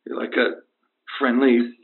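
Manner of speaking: low, halting, sentimental confession; deathbed farewell. Acted. Injured caller to his idol from hospital, weak deathbed farewell. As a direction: pause heavily between tributes, as if fading.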